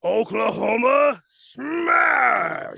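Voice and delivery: high, loud battle cry; hero attack shout. Acted. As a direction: bellow the attack name.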